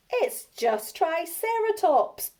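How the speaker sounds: high, slow gleeful taunt; mocking laugh. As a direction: gloat that it was only Triceratops. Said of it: "Bonehead laughed".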